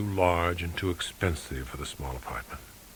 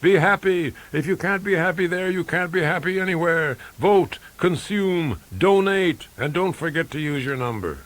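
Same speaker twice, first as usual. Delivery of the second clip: high, loud, chanting announcement; broadcast propaganda message; 10 semitones higher, 6 dB louder than usual. A narrator's reading. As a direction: boom out orders to inmates, pitched way up. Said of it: "beaming a gigantic electronic message at the inmates".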